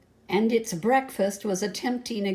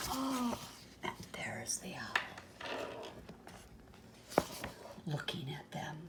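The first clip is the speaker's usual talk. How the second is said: voiceless hushed with a gasp; gasp and whisper; 6 semitones lower, 15 dB quieter than usual. Real, not acted. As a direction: whisper in mock alarm. Scary moment whispered.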